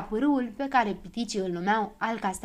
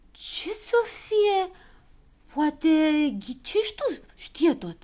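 high, halting voice; puzzled character; 7 semitones higher, slower than usual. A narrator's reading. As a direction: ask slowly in a high voice. Puzzled character questions the leader.

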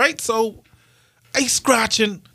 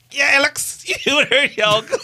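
higher voice, laughing through it; laughing imitation; 7 semitones higher than usual. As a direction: mimic the old man while laughing.

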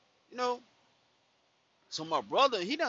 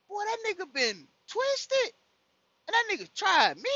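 outraged disbelief; 12 semitones higher, 3 dB louder than usual. Retold or imitated. Outraged realisation.

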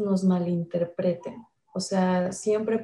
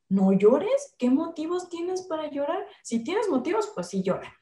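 impatient mother; 7 semitones higher than usual. Retold or imitated. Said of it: "su primera reacción es".

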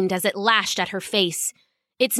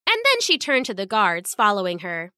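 ordinary delivery, nothing marked

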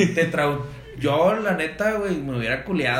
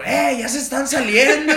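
high, loud laughing alarm; half-laughing, sound the alarm; alarmed call; 10 semitones higher, 5 dB louder than usual. Retold or imitated. A guy shouts that they are escaping, high and loud. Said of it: "volteó un morro".

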